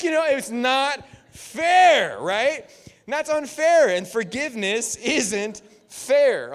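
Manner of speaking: slow, high, loud, drawn-out emphasis on fair; preacher pressing the lesson home; emphatic point. Real, not acted. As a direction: hammer home that it's not fair. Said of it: "preacher drives the point home".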